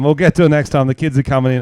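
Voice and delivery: cutting in loudly to wrap things up; hurried loud wrap-up. Real, not acted. Host cutting off his guest as the kids come in, loud.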